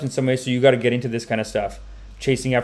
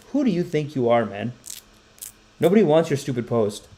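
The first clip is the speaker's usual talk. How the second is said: ordinary talk, nothing marked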